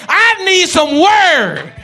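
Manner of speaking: slow, drawn-out shout; shouted plea. Acted. Preacher crying out to the church, slow shout. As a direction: stretch it out and shout it.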